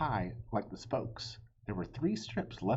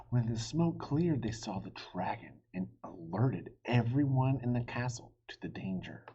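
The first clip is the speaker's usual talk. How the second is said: ordinary delivery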